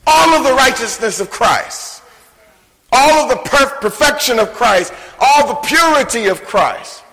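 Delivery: preacher swelling to a fervent peak; preaching climax. Acted. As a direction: build it up like a pulpit crescendo. Sermon climax building.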